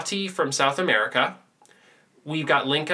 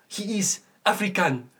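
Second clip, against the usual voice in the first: mock African accent; 5 semitones higher than usual. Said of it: "The accents are hilarious".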